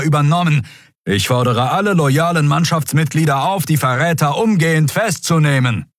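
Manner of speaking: high-pitched official loudspeaker proclamation; urgent, commanding public address; thundering announcement. A narrator's reading. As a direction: proclaim it as an admiral over loudspeakers. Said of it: "aus einem verborgenen Lautsprecher über ihr donnerte".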